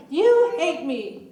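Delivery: slow, loud, accusing shout; loud accusing yell. Shown as a demonstration. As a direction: yell it slowly at your husband. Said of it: "Say it louder".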